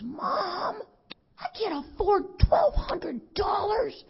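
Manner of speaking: high, slow, tearful wail of a son; imitating son crying. Son hearing the payment, sobbing and shouting. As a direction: mimic the grown son breaking down in tears. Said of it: "And he loses it, and he cries".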